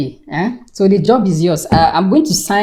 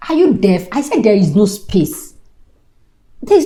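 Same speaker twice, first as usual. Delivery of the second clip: angry retort. Acted. Boss angrily refusing the jobseeker.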